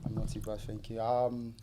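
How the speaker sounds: put-on quiet, formal greeting to a girlfriend's father; nervous suitor meeting the dad; polite suitor. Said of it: "You gotta role play".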